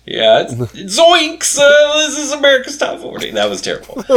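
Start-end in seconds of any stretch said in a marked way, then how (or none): 1.0-1.6 a high squeaky stoner yelp; Shaggy voice. Retold or imitated. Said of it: "stoner head mindset". 1.6-3.3 high, fast cartoon stoner announcer; Shaggy doing radio DJ. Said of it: "just think of Shaggy".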